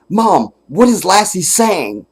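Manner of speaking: put-on high, eager sitcom-kid voice; imitating Jim Carrey. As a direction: call to the dog like an earnest TV boy. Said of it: "And then".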